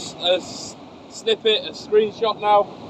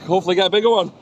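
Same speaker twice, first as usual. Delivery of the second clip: ordinary talk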